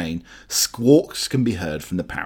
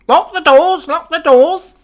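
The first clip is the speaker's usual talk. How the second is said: very high, loud parrot squawk; shrieking parrot imitation; parrot shouting; 10 dB louder than usual. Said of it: "you'll start shouting".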